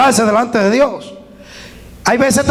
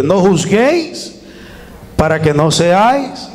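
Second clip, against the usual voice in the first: scripture reading; 4 semitones lower than usual. Acted. Reads Mateo siete.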